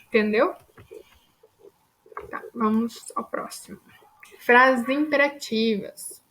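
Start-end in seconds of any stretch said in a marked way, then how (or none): 2.3-3.8 whisper. Real, not acted. Aside before next topic.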